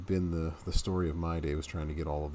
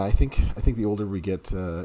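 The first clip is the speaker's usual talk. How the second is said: ordinary delivery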